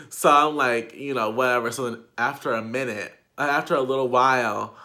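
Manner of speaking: ordinary delivery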